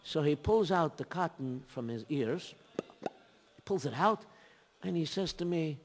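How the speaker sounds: usual speaking voice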